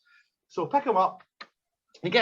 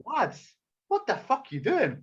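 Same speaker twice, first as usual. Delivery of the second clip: higher voice of a bewildered bystander; lightly voiced onlooker, raised pitch; alarmed onlooker; 5 semitones higher than usual. Friend getting out of van at a fight, alarmed protest.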